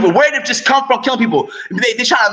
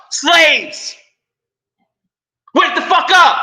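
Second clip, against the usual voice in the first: furious shout; 7 semitones higher, much slower than usual. Real, not acted. Vlogger yelling at his viewers to wake up, furious shout. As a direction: bark it at the whole audience.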